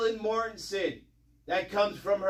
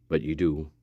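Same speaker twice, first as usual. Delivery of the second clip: low whispered aside; 4 dB quieter than usual. Real, not acted. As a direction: whisper it pointedly. Unvoiced aside.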